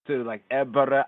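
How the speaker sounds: ordinary delivery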